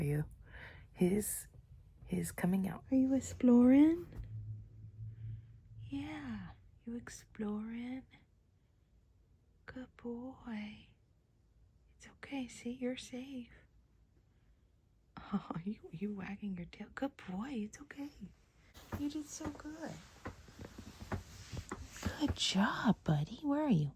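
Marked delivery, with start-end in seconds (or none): 2.9-18.1 cooing to a scared pet. Real, not acted. 19.0-21.2 very slow, soft, high praise; cooing to a scared pet. Real, not acted. Praising the shy cat.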